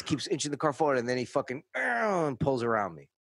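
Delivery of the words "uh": high engine noise sliding down; vocal vroom, pitched way up; imitated car revving. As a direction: mimic a car swerving away. Imitates car pulling away.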